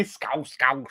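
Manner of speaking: mock Scouse, rasping the end of the word; Scouse accent. Shown as a demonstration. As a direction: demonstrate a Scouse throaty ending. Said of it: "when you say".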